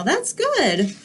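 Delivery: usual speaking voice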